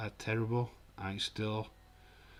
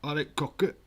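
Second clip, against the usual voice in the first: regional English accent; 3 semitones higher, 4 dB louder, slower than usual. Shown as a demonstration. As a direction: exaggerate a local English accent. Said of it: "You don't get this".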